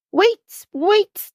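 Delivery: put-on high, insistent toddler call; a small child pleading to stop; lightly voiced little brother, eager; demanding little boy. A narrator's reading. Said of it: "demands Joey, straining from his buggy".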